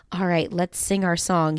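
usual speaking voice

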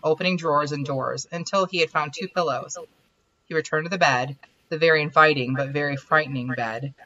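reading her romance story aloud at an even pace; the author reads her own chapter to the writing group; story narration. Acted. Story narration read aloud.

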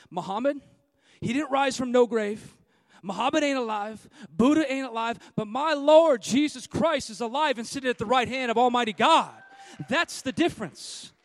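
loud, driving preacher's proclamation; thundering pulpit declaration; full-force preaching. Acted. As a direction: hammer it home to the congregation.

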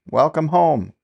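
high, bright shout of welcome; cheerful calling. Acted. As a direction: greet the couple with a call. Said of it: "laughing and calling".

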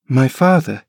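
put-on high, puzzled child's cry; a startled boy echoing the word; perplexed boy. Boy Linton learning of a father, high perplexed cry. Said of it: "he cried in strange perplexity".

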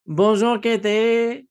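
high, sing-song French Bonjour; teasing a friend in fake French accent; mock French accent. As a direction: greet her in a hammed-up French accent. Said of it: "Is that supposed to be a French accent".

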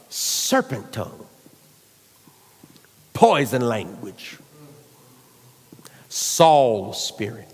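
deep, low, half-whispered; low hissing voice. Shown as a demonstration. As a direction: hiss it low like a serpent. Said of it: "hear me talk like this".

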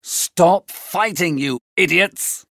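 slow, forceful command without real volume; shouted order. A narrator's reading. Benvolio breaking up a street fight, contained shout. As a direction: order the brawlers apart in character. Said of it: "Benvolio shouted".

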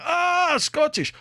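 Scottish in a high, hammed-up Russian accent; delighted Russian accent, Scottish drawn out and falling. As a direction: imitate the Russian worker catching on in a Russian accent. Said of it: "some Russian guy went".

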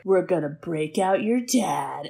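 low, hushed hero voice; understated mock-tough elf; tough elf rescuer. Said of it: "they are like".

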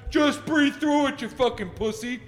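mock his old tough-guy self; dismissive tough guy. His past self brushing off panic attacks, mocking. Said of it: "I'd always been, like".

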